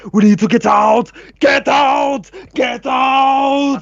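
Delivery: panicked yell. Acted. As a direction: yell it while fleeing. Fleeing panic shout.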